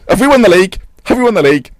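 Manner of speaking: loud, high, eager question; asking aloud if the title is won, excited; excited disbelief. Real, not acted. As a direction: ask it in thrilled disbelief.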